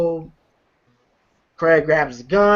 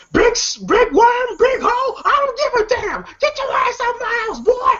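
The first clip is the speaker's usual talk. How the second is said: put-on squeaky hollering old father; John Witherspoon impression. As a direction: shout it like a furious dad kicking the boy out. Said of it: "He comes in the room and goes".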